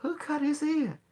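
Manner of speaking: high, puzzled innocent question; asking months later who hurt the cat, high; mock surprise. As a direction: act like you have no idea. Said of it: "I said".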